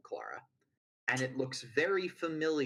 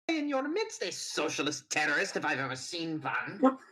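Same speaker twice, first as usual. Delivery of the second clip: sneering accusation; 11 semitones higher, 4 dB louder than usual. Acted. Villain accuses loudly.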